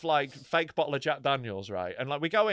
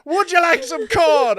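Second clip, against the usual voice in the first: high, loud, slow mock-spooky offer; hammed-up haunted-cornfield voice; failed creepy actor; 11 semitones higher, 11 dB louder, slower than usual. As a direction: boom it out slowly, trying to scare. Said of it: "he's trying to be creepy".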